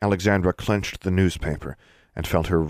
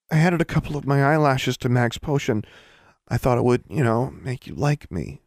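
put-on higher-pitched, hesitant young sibling; sheepish confession; 8 semitones higher than usual. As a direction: admit it haltingly in a higher voice. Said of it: "Awesome mumbled".

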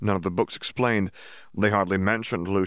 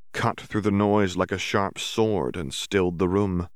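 usual speaking voice

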